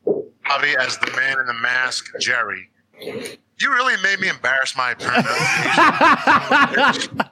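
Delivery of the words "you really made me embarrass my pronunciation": higher, slow, laughing complaint. Real, not acted. Teasing co-hosts after botching Italian names, laughing protest. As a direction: protest through helpless laughter.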